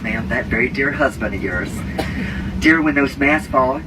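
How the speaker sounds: ordinary talk, nothing marked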